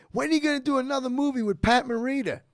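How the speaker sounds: high, loud blurted question. Retold or imitated.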